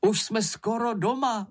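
high-pitched excited call; Mumínek calling out. A narrator's reading. Moomin nears home with his friends, calling excitedly. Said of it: "volal Mumínek".

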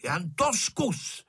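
put-on a read-aloud child's defiant warning; bristling dare. A narrator's reading. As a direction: bristle and dare him, slowly. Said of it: "načepířil se Honzík".

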